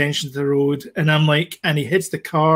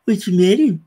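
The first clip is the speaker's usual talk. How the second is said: creepy voice; 6 semitones higher, 5 dB louder than usual. Pretend. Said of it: "stop doing that, you're freaking me out".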